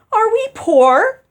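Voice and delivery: loud, high, slow panicked question. Acted. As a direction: let fear push the voice up. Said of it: "her voice rising in panic".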